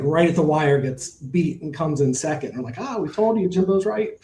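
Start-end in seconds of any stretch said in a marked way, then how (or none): 2.8-4.1 high, gleeful told-you-so; retold told-you-so, high and gleeful; excited gloating. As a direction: crow that you were right all along, pitched up. Retelling a win after the race.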